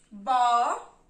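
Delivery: "Bó" drawn out alone, loud; teacher loudly marking the syllable "Bó"; syllable demonstration. Shown as a demonstration. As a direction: sound out "Bó" as one long, separate syllable. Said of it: "Eu vou marcar a sílaba".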